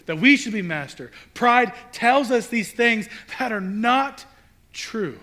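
emphatic sermon build; preaching. Acted. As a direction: hammer each line about pride. Preaching at full force.

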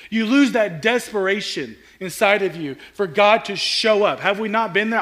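ordinary talk

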